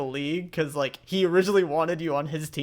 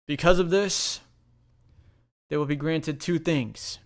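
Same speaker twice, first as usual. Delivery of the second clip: formal proclamation. Acted. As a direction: proclaim it like an official, with weight. Commissioner proclaims to the crowd.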